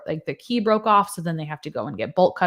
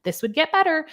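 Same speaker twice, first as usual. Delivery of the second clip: higher-pitched, condescending mimicry; mock-soothing, patronizing quote in a raised pitch; 7 semitones higher than usual. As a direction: imitate someone talking down to a patient. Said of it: "being, like, really patronizing".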